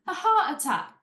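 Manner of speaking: playing a soap hardman with an admittedly bad EastEnders accent; bad EastEnders accent for Phil Mitchell. A mock Phil explaining a diagnosis, bad EastEnders accent. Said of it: "And he goes".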